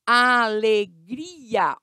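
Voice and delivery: "Alegria" very slowly as a-le-gri-a; separated syllables. Shown as a demonstration. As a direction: draw out "Alegria", parting the i from the a. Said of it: "elas ficam em sílabas separadas".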